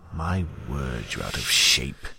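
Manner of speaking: low other-voice mockery; mocking inner voice. Leech mocking Damien's fitness inside his head.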